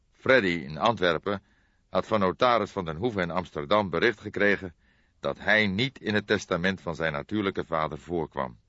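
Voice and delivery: ordinary delivery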